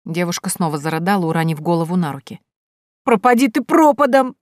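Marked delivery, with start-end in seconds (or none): 3.0-4.4 high, loud wailing curse; tearful curse. A narrator's reading. Sobbing girl cursing the heroine, wailing loudly. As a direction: fling it out through sobs.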